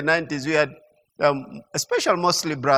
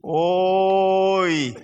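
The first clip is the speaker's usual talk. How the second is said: long drawn-out Central-style "Oi"; Central regional exclamation; 2 semitones lower than usual. Retold or imitated. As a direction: stretch the Oi as people from Central do. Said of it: "expression that I hear people from Central".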